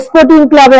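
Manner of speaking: স্পোর্টিং said as "esporting"; added vowel before s. Retold or imitated. Teacher imitates local speakers, স্পোর্টিং with a leading e. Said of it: "তাদের ক্ষেত্রে এস উচ্চারণটা আসবেই".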